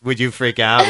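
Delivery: usual speaking voice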